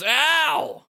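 high loud yelp of pain; comic ow. Acted. Wakka stubbing his foot on a cannon, loud high yelp. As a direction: yelp as your toe hits metal.

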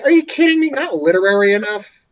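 high and loud disbelief; are you kidding me, outraged; incredulous. Real, not acted. Incredulous at critics.

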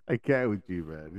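high giggle breaking into words; laughing. Real, not acted. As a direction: crack up mid-sentence. Cracking up at cohost.

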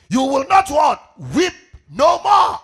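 slow, booming call to the congregation; emphatic shout. Real, not acted. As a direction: shout it out, stretching each word. Preacher drives verse home to congregation.

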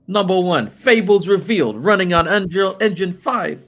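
high, slow hammed-up New York accent reading. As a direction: read the list like a mock New Yorker, stretched out. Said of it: "I'm gonna do it in New York accent".

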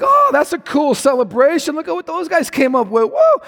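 high, excited mock fan voice; gushing fan. Fan impressed by team's celebration, excited high voice. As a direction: hype it up like a thrilled spectator. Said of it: "and you're like".

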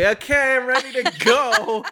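very high, chirpy put-on voice with a laugh; faking readiness with bloodshot eyes; mock cheerful self. Heading out to the event, chirpy and laughing. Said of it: "I'm like".

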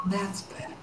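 soft whisper in the ear; hushed, breath-only approval. Acted. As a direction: whisper it as an unseen voice. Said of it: "you hear the voice in your ear".

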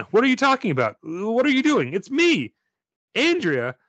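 high, loud panicked pleading. Acted. Panicked plea to possessed friend.

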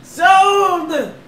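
high, loud auction call; auctioneer. Pretend. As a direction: bang the gavel and sell it.

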